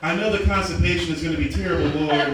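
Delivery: hammed-up preacher praying aloud; mock prayer. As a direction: plead fervently with God over petty things. Comic mock prayer at full voice.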